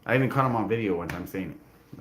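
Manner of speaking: usual speaking voice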